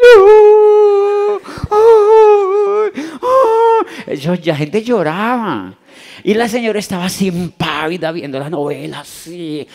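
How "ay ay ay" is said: high, loud hammed-up soap-opera cry; overdone melodramatic sobbing ay; mock telenovela wail. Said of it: "Latinoamérica era así".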